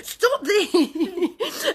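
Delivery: high laughing cry of stop; laughing protest. Real, not acted. Presenter laughing as the whale puppet bops her, high squeal.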